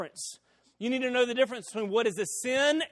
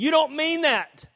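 higher, louder, slow scoffing; disbelieving retort; 7 semitones higher, 5 dB louder than usual. Retold or imitated. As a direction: throw it back doubting him. Angry opponent doubting him.

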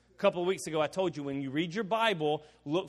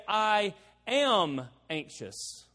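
"I... am... anxious", drawn out slowly; slow, separated words with weight on "am"; stress on AM; 2 semitones higher, much slower than usual. Shown as a demonstration. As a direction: lean long and hard on "am". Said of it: "sometimes we say".

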